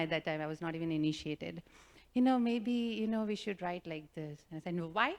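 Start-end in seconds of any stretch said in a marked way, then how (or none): none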